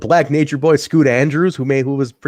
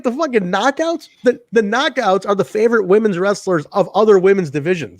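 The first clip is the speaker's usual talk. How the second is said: retold indignation; 7 semitones higher, 4 dB louder than usual. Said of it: "I'm like".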